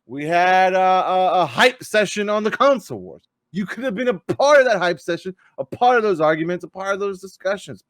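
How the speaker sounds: rally the viewers like a hype man; hyped-up plea. Real, not acted. Streamer to YouTube viewers, pitching the Twitch show in a high, pumped voice.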